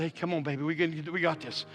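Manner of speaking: soft, low reassurance; gently coax, voice dropped low; tender coaxing. Retold or imitated. Husband taking wife's hand in labor, tender coaxing.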